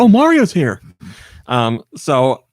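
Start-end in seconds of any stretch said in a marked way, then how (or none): 0.0-0.8 high, loud burst of delight; excited imitation, pitched up and loud. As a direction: exclaim it like a thrilled fan spotting a star. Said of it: "He was very excited. He's like".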